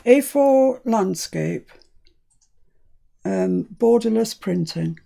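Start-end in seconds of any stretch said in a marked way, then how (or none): 0.0-1.7 A four landscape said slowly, each word spelled out; repeating A four landscape slowly to make it plain; stress. Real, not acted. Correcting the helper, A four landscape said slowly and firmly. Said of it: "that's what you just said".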